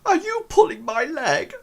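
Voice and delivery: high falsetto, doubtful question; suspicious girl. Acted. Erin questions the orc at her door, suspicious falsetto. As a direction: play a wary girl in falsetto.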